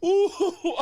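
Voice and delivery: loud, high burst of laughter; laughing whoop. Real, not acted. Still laughing.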